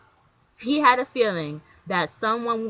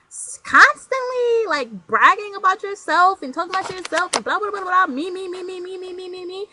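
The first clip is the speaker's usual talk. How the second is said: mock bragger; 10 semitones higher, 6 dB louder than usual. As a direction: parody someone who only talks about themself. Mocking a self-obsessed bragger.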